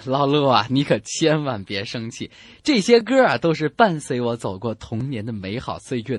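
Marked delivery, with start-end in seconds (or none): none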